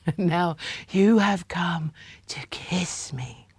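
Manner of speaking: slow dreamy whisper; faraway whisper. A narrator's reading. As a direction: whisper as if half asleep. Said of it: "in a faraway voice whispered".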